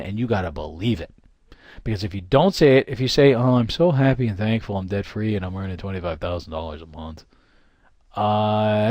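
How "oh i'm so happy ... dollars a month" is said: lower, listless, no belief at all; flat, unconvinced. Shown as a demonstration. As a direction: mumble it like you don't buy it. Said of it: "it's not gonna work".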